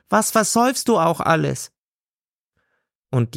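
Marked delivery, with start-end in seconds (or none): 0.1-1.7 high, scolding woman voice; scolding seller. A narrator's reading. Gailana tells God to go home, high and scolding. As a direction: tell him off sharply.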